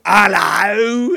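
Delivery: a loud, hammed-up character British hello; over-the-top British accent. Shown as a demonstration. Showing the cohost an overdone British hello, loud and exaggerated. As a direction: push the British accent big, like a caricature. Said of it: "there's a difference between the two".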